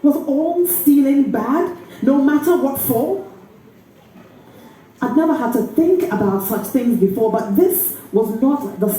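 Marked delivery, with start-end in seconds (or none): none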